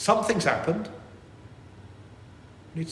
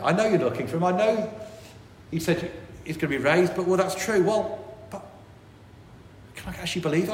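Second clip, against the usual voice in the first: higher, worried, questioning voice; anxious doubting woman; 6 semitones higher than usual. Acted. Women's anxious inner voice.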